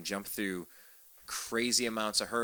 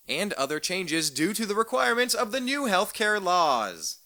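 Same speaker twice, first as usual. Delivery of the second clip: higher, louder, slow outraged read; outraged reading of the insurance notice, slow and loud; indignant reading; 10 semitones higher, 7 dB louder than usual. Real, not acted. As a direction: build it into an indignant, drawn-out complaint.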